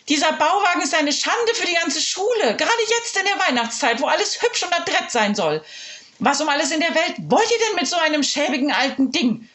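high, haughty headmistress voice; disdainful principal. Acted. Principal scorning the trailer, high and haughty. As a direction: look down on the shabby trailer.

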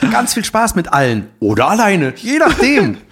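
high, gushing video-greeting voice; overly sweet canned well-wishes; cheesy celebrity greeting. Pretend. Continues the mock celebrity greeting.

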